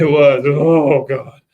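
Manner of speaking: loud, hammed-up dismay; very dramatic. Host agreeing and replaying the reaction, dramatic. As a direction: belt out an exaggerated groan of despair. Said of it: "that was very dramatic".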